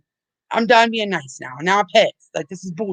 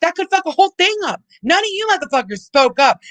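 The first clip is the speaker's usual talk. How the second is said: angry rant; 8 semitones higher than usual. Real, not acted. Streamer venting fury at critics in chat, high heated rant. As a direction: voice climbing much higher in heated outrage.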